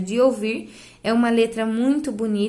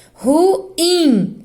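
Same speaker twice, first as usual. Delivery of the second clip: "Ruim" in two beats, ru-IM, higher; stress on IM; 5 semitones higher, 4 dB louder than usual. Shown as a demonstration. Teacher showing the tonic syllable, ru-IM split and raised. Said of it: "A sílaba tônica é in".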